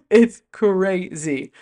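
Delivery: CRAZY stretched very slowly into "cu-ray-zy"; drawn-out emphasis. Real, not acted. Fan marvels at the growth, stretching "crazy" in amazement. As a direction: pull "crazy" apart syllable by syllable.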